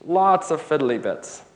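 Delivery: ordinary talk, nothing marked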